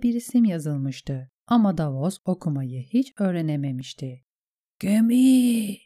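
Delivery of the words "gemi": high, loud, long-held hail; calling out. A narrator's reading. Davos hails the ship from the rocky top, calling out. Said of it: "diye seslendi tekrar".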